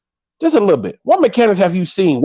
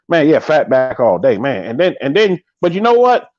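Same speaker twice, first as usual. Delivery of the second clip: ordinary talk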